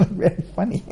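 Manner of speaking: laughing as he retells the joke; acted laughing. Husband tells his wife about the birds, laughing. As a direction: break into high, loud laughter over it. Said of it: "he started laughing".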